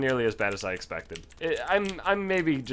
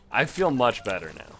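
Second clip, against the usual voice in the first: normal talking voice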